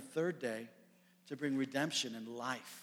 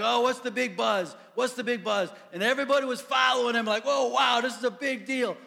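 loud, higher buzzing crowd chatter; excited crowd; 8 semitones higher, 9 dB louder than usual. Retold or imitated. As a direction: play the thrilled crowd, louder and higher. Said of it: "Everyone was like".

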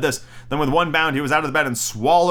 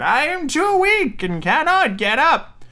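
put-on old grandmother voice; slower than usual. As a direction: call out like a frail grandmother. Grandmother calls out.

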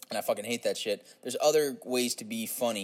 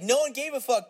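normal talking voice

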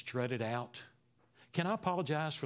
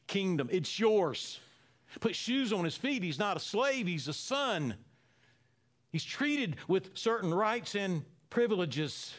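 higher and louder, rising fervor; preaching at full force; 7 semitones higher, 4 dB louder than usual. Acted. Preacher exulting to the congregation over the returning son, fervent. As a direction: preach it out to the whole church.

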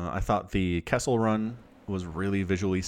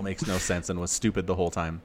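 ordinary talk

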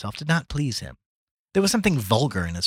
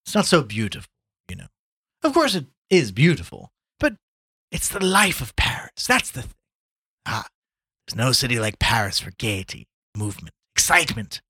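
lightly voiced bon vivant, louder; worldly Gallagher, enthusing; 4 semitones higher, 5 dB louder than usual. Gallagher tells Chandler of the life of Paris, enthusing. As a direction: sing the praises of a favourite city.

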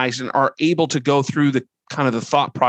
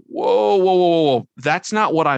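startled whoa; 5 semitones higher than usual. Retold or imitated. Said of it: "I'll go".

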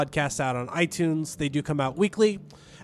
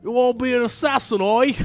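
put-on jokey British-Australian accent, pitched up; botched British accent; 5 semitones higher than usual. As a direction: ham up a bad London accent. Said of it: "we need British ones".

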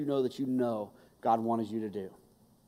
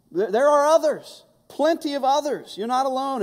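firm, emphatic reassurance rising high; lightly voiced God comforting; God reassuring; 5 semitones higher, 6 dB louder than usual. God answering Elijah, emphatic and reassuring. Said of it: "God's actually gonna say".

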